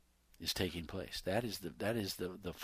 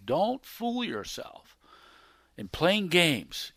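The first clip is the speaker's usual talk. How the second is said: very slow, high, drawn-out warning; deliberate pulpit warning; 7 semitones higher, 6 dB louder, much slower than usual. Acted. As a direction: stretch every word to warn them. Preacher warning the congregation.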